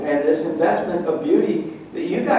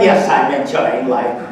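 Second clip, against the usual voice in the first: mock gloomy yes; 4 semitones lower than usual. Mock grumpy reply.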